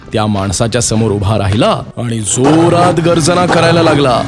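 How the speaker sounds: usual speaking voice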